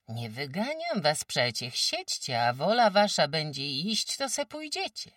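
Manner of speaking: a brisk countrywoman sizing up a sick guest; sturdy farmwife. Acted. Farmwife Kłębowa tells old Agata she's too weak, brisk.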